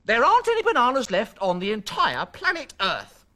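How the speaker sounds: high, loud, desperate protest; worked-up, emphatic explanation; exasperated mum. A narrator's reading. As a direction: plead with the impossible son at full pitch. Exasperated mum tells demanding son.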